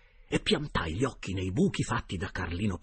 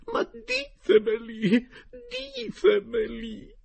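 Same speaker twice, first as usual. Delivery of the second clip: much higher, loud and slow tearful wail; wailing lady; 9 dB louder, much slower than usual. A narrator's reading. Geltrude begging the uncle's spirit, slow high tearful wail. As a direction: plead through tears. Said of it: "poi riprese".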